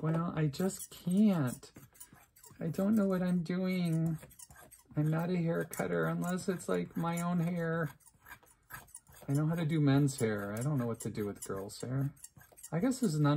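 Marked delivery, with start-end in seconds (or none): 0.0-7.9 higher, slow whiny excuse; whiny helpless reply. Retold or imitated. Vlogger answers the imagined viewer, higher and whiny. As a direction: plead helplessly, like a flustered kid.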